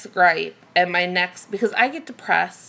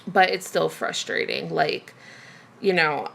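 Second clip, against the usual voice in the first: ordinary talk, nothing marked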